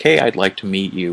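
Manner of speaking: normal talking voice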